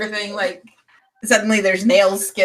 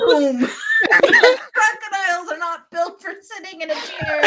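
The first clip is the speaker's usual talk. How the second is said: laughing hard; 9 semitones higher than usual. Real, not acted. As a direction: say it through real giggles. Cracking up at the scene.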